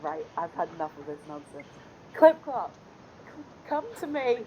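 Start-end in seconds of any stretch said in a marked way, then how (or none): 2.2-4.4 high summoning call to a horse; calling steed. Pretend. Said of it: "I raise my hands in the air".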